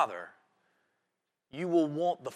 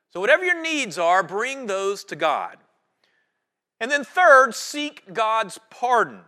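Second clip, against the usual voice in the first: rising preaching call; 5 semitones higher, 7 dB louder than usual. Real, not acted. Preacher building to third point.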